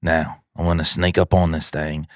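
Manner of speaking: mock Australian wildlife-show host; hushed excitement of a croc hunter in Australian accent. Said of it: "an Australian guy".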